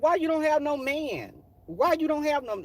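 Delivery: high-pitched nosy queen impression; pry with over-the-top diva flair; extra diva act. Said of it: "act like they need leaks and they do all this extra".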